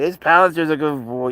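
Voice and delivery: a brighter coo at the cat. Shown as a demonstration.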